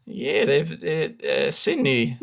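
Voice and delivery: put-on broad Aussie accent, chatty; Australian accent. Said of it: "such a brilliant Australian accent".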